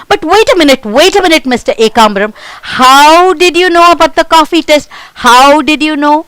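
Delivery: high, loud, fast amazement; astonished. Acted. Meena amazed, pressing the mouse how he knew. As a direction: demand to know, stunned.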